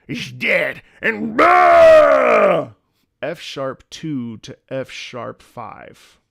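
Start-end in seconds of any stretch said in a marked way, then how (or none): 0.1-2.7 slow, high, loud roaring yell; hammed-up orc bellow; mock orc roar. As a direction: bellow the last words like a war cry. Ends orc chant with yell.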